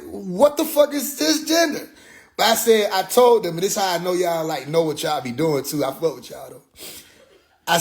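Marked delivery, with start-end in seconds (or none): 0.1-1.9 high, slow bewildered question; mock baffled. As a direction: act totally lost.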